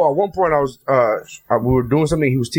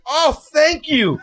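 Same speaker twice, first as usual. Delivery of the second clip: high, drawn-out mock relief; delighted gratitude; 8 semitones higher, much slower than usual. Gym member thanking a yelling instructor, with hammed-up gratitude. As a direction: draw out the thanks, pitched well up.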